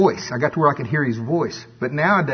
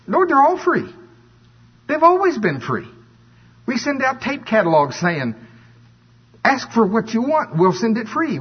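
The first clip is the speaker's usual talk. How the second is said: indignant protest; 9 semitones higher than usual. Acted. Preacher acts out protesting to God.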